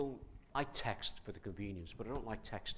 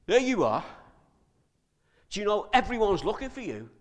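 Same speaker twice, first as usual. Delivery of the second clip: much higher and louder exclamation; exclaimed face to face; 10 semitones higher, 16 dB louder than usual. Shown as a demonstration. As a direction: burst out at finding him. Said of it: "Let's see what really happened".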